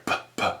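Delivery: puh, puh as soft unvoiced lip pops; showing the plosive pops in the recording; mic pops. Shown as a demonstration. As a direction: pop the p sounds into the mic. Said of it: "You're hearing the puh".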